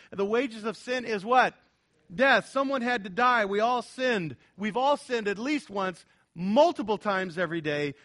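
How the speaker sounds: fervent sermon peak; preaching at full force. Acted. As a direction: build the call on sin and death. Preacher rising to climax.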